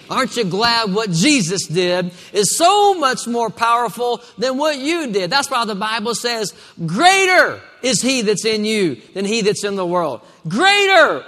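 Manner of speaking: loud, high, rousing preacher's cry; full-force preaching. Real, not acted.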